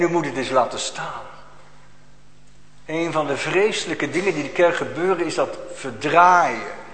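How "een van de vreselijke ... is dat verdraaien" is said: usual speaking voice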